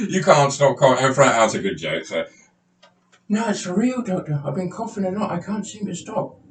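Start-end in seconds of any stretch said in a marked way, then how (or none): 3.3-6.4 much higher, put-on voice of a timid patient. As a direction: play the nervous patient, pitched well up. Patient insists.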